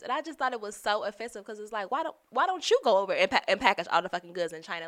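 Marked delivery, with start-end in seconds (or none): 1.9-4.9 louder and faster, building indignation; indignant outburst. Retold or imitated. To the coworker who wanted her packaging in China, louder indignant outburst. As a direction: fire it off like you can't believe the nerve. Offended rant about coworker.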